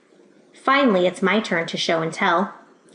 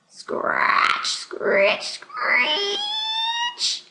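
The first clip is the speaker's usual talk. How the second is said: scratch and screech; 3 dB quieter, much slower than usual. Acted. Noise at the window.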